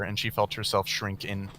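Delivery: plain reading voice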